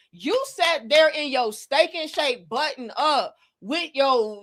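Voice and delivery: ordinary delivery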